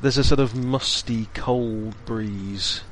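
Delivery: slow, hushed scene-setting narration; game master scene setting. A narrator's reading. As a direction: paint the gloomy crypt slowly. GM describes the crypt scene.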